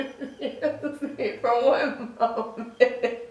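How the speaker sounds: slow words squeezed through real laughter; helpless laughter. Real, not acted. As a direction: try to excuse yourself while cracking up. Cracks up over her bullying past.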